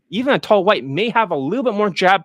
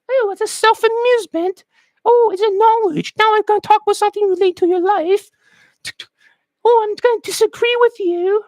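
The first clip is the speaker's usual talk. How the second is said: tiny British twit in high falsetto; little British person; 12 semitones higher than usual. Acted. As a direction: squeak it out as a mock little Brit. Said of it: "that's how it's like for the little shit".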